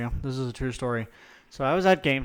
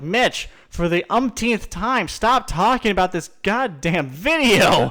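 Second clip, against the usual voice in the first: high, loud mock outburst, drawn out; exasperated friend; 6 semitones higher, 4 dB louder, slower than usual. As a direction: imitate a fed-up friend, hammering it out slowly. Friends fed up with quotes.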